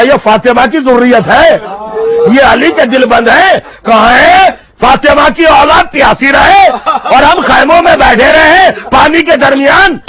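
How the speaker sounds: high, ringing preacher's cry; impassioned outrage. Acted. Hur telling his brother whose children thirst, impassioned outrage.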